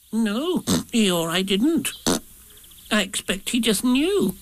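high little piglet voice; timid little piglet. A narrator's reading. Piglet denying he told Rabbit, timid. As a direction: answer meekly, pitched high.

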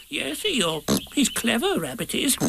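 very high, soft piglet voice; meek little piglet. A narrator's reading. Piglet explaining to upset Eeyore, meek. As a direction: answer meekly in a little high voice.

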